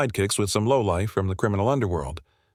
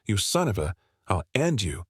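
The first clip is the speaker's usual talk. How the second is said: plain reading voice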